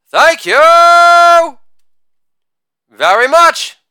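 high, loud, held-out thanks; drawn-out showman's thank-you with a hanging pause; showy sign-off. Acted. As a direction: stretch the "you" out high, pause, then finish.

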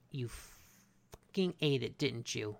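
slow, low-volume mock accusation; understated deadpan confrontation; quiet accusation. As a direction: imitate knowing suspicion, dropping the volume. Papa Smurf confronts Greedy, retold.

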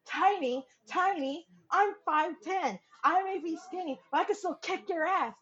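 high, offended mock voice; mock offended brother; indignant brother. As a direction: huff it out, offended.

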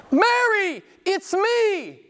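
very high-pitched calling out; exclamation. Retold or imitated. Jesus reveals himself to Mary, exclaiming. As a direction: call to her, pitch soaring. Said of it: "Exclamation point".

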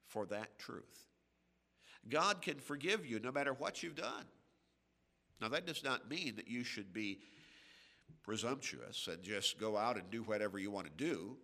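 normal talking voice

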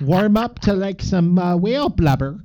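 folksy Canadian lilt; Canadian accent. Acted. Joking to Canadian listeners about whale blubber, Canadian accent. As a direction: keep up the put-on Canadian accent. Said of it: "for a Canadian listener".

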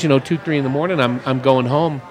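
normal talking voice

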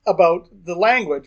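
ordinary talk, nothing marked

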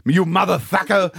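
laughing greeting. Acted. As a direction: burst in laughing with mates. Sven arrives loudly laughing.

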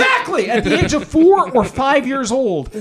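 high, loud, excited agreement; real excitement. Real, not acted. Fan agreeing with co-host about Star Trek's message, excited.

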